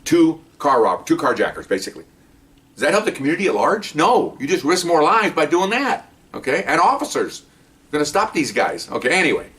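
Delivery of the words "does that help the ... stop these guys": indignant, higher-pitched lecturing; worked-up soapbox rant. Real, not acted. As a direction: drive the point home from a pedestal, pitch raised. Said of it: "Get off my, get off my, my, my pedestal here".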